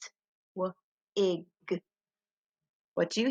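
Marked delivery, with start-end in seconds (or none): 0.0-1.5 "T-w-ig" split into separate soft sounds, one tap each; tapping out sounds. Shown as a demonstration. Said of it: "we're going to tap out the word".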